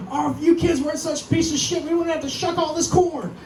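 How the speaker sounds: high-pitched mock scolding of the kids; scolding mom. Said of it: "mad at their kids going".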